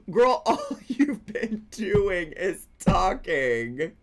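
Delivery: slow, laughing disbelief; amused slow laugh through the words. Real, not acted. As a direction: laugh it out at her nonsense. Laughs at caller's claim.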